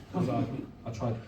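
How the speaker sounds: ordinary talk, nothing marked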